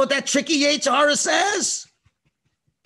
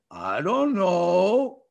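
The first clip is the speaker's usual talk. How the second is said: long drawn-out innocent sing-song; child feigning innocence; 5 semitones lower, much slower than usual. Acted. Guilty child answering mommy, drawn-out fake innocence. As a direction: stretch every word in mock innocence. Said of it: "you know what you say?".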